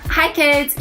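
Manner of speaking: ordinary delivery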